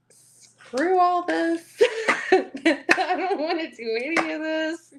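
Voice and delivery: high, slow mock exasperation with a laugh; a hammed-up, high "screw all this"; mock fed-up. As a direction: play the fed-up querent, laughing. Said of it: "you're gonna feel like".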